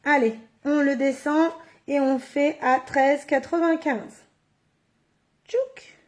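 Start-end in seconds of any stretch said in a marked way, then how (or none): 5.5-6.0 soft, high-pitched click noise; high "tchouk" sound. Real, not acted. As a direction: chirp a small folding noise. Imitates the tool while folding. (petit « tchouk » aigu et doux)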